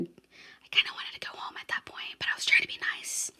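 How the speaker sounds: soft confiding whisper, barely voiced; whispered aside. Real, not acted. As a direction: let it slip out as a secret whisper.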